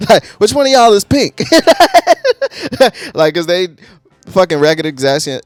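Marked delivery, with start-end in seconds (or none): 1.5-3.1 loud high burst of laughter; real laughter, high and loud. Real, not acted. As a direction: crack up at the joke. Laughing at the lyric.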